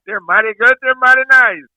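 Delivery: high, singsong street-seller's cry; yelled vendor pitch. Retold or imitated. Former candy apple seller re-enacts his saying, yelling. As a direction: hawk your wares to a passing crowd. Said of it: "you have to yell at somebody".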